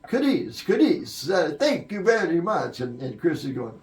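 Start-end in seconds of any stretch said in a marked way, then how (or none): 0.1-2.8 high and loud, a mock stage thank-you; singer's strained thanks. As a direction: imitate the singer thanking the crowd, pitched up. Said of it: "He went".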